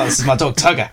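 put-on Australian accent. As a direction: play at being an Australian dog owner. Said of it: "I pretend that I'm Australian".

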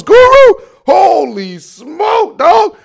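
high, loud, stretched cry of alarm; mock panic. Son realizing he forgot his mom's birthday, panicked high wail. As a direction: wail it like a disaster, pitch shooting up.

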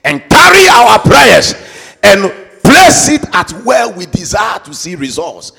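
booming declaration, preacher at full force; fiery preaching. Acted. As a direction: proclaim it to the congregation with all your strength.

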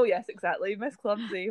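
ordinary talk, nothing marked

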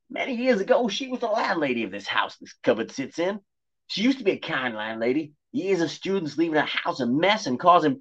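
high, prim cat voice warning of danger; posh talking cat. Acted. Cat warning the boys of danger, high and prim. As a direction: play the cat as a fussy gentleman.

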